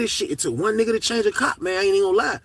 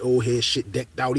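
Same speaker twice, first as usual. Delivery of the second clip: usual speaking voice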